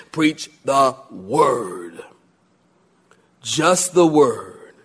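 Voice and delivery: slow right down and lay it out; slow solemn preaching. Acted. Preaching climax.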